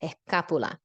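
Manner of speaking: Escápula with the ka lifted and stressed, es-KA-pu-la. Shown as a demonstration. Teacher shows students the stressed syllable, lifting on ka. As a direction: lift the voice on ka in Escápula. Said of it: "so you need to lift on ka".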